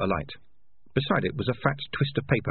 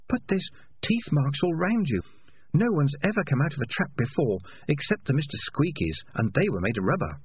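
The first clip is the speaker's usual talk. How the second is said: higher, wondering character voice; lightly voiced awed young rat; 6 semitones higher than usual. A narrator's reading. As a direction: marvel at a survivor, pitched up.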